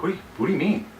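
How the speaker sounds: ordinary talk, nothing marked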